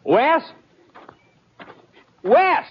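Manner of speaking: high calling shout; hollering. Acted. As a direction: holler out for someone, pitch leaping up. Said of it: "What are you hollering about".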